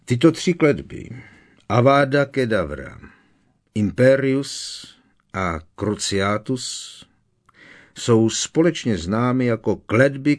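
a teacher's firm, loud lecturing voice; stern Professor Moody. Acted. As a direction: warn the class like a hard old Auror.

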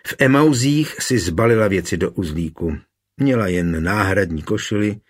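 plain reading voice

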